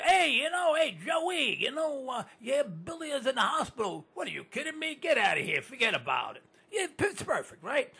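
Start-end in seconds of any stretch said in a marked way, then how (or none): 0.0-6.4 higher, lively New York banter; New York guys talking. Retold or imitated. Host acting out Mikey and Joey, New York accent. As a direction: play two New York guys chatting. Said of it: "Mikey can come up to you".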